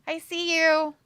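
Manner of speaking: loud teasing shout; loud call-out. Acted. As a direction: call out at the screen.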